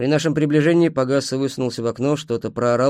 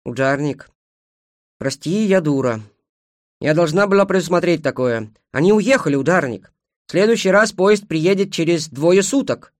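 upset, apologetic, rising higher and louder; genuinely upset; 6 semitones higher, 5 dB louder than usual. A narrator's reading. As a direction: apologise in growing distress. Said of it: "Она была по-настоящему расстроена". (расстроенно, виновато, всё громче)

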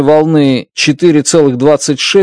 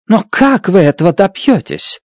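insistent; 6 semitones higher than usual. A narrator's reading. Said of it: "настаивала Страт".

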